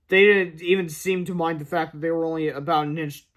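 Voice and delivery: usual speaking voice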